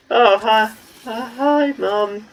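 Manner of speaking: high, awkward fake-cheerful hi; forced cheery greeting. Son greets mum with awkward fake cheer, high. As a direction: chirp it to cover embarrassment. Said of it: "I'm like".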